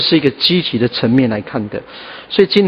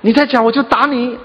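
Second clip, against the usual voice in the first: furious threat; 8 semitones higher than usual. Retold or imitated. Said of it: "哦，他就发火了".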